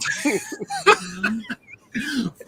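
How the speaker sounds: long, high-pitched cackle; long high laugh. Real, not acted. Laughing at Ben Davies joke.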